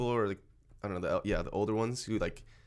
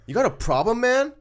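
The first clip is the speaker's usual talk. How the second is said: imitating a confrontational customer, loud and higher; mock challenge climbing high at the end; pissed-off challenge; 13 semitones higher, 7 dB louder than usual. Retelling the angry customer confronting him at Costco, pissed-off challenge. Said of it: "This guy was just pissed".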